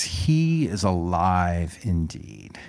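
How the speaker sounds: ordinary talk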